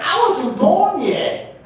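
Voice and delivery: high, drawn-out mock protest; high-pitched protest. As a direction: stretch it out high and loud, imitating a youngster. Young man's protest, retold.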